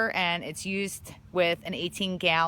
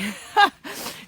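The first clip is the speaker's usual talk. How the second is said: breathy laugh; 9 semitones higher, 5 dB louder than usual. Real, not acted. Laughing at being cold.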